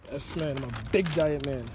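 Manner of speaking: ordinary delivery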